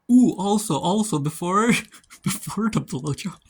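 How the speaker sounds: bursting into laughter, high and loud; giggly excited gushing; giggling. Real, not acted. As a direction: laugh through the words, can't hold it in. Giggling recap of a racy scene.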